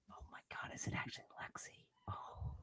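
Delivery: shocked whisper. Real, not acted. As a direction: whisper it in disbelief.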